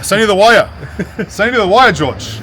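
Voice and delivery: high, loud mock Australian accent; exaggerated Australian accent. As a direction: call it out in put-on Australian. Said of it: "I think I got the Australians down".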